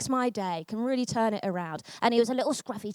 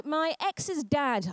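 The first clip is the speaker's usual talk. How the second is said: ordinary delivery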